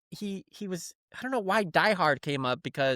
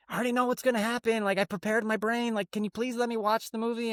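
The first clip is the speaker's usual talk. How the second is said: imitating a kid's higher-pitched, hurried plea; pleading son; 8 semitones higher than usual. Son begging dad to watch Sweeney Todd, higher pleading voice. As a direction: make the kid's case in a raised voice.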